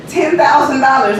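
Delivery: normal talking voice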